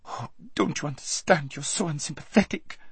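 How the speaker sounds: high, whiny near-tears voice; spoiled girl starting to cry; tearful complaint. A narrator's reading. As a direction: whine on the verge of tears. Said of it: "cried Lazareline, beginning to cry".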